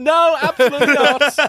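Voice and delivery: high, retold outburst of refusal; emphatic refusal. Retold panicked refusal to drive.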